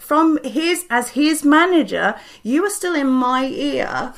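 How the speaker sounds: usual speaking voice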